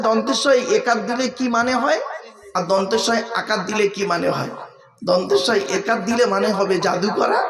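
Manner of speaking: normal talking voice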